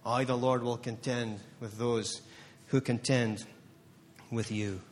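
low, soft and slow; soft, low, slow reverent promise; hushed. Acted. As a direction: drop it to a hush, each word weighed.

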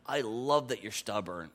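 soft, low and loving; tender God voice. Retold or imitated. Pastor voicing God's tender words to him. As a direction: speak it gently as a loving father.

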